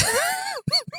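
a squeaky giggle; short high laugh at the joke. Real, not acted. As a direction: burst of high-pitched laughter. Laughing at the lyric.

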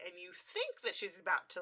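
usual speaking voice

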